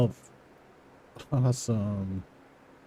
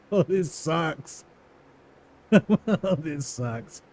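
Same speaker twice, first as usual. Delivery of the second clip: laughing groan; 2 semitones higher, much slower than usual. Real, not acted. Laughing groan at a long task.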